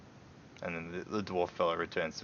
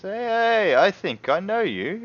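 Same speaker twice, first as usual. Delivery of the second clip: guard calling out; 12 semitones higher, 7 dB louder than usual. Acted. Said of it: "he turns around and goes".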